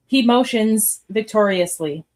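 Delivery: usual speaking voice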